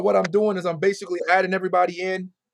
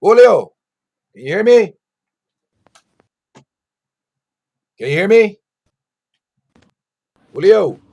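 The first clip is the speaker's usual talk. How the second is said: loud call into the mic; 2 semitones higher, 13 dB louder than usual. Real, not acted. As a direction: call out to be heard. Calling to a remote guest on stream.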